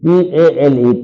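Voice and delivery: T A L E spelt slowly, letter by letter; spelling out letters. Shown as a demonstration. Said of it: "T E L.". (अक्षर-अक्षर धीरे बोलना)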